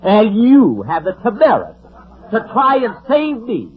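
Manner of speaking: hammed-up indignation at the thrift; indignant scolding. Jack berates Don for saving him money, mock outraged.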